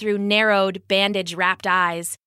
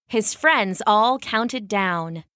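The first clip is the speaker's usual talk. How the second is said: ordinary delivery, nothing marked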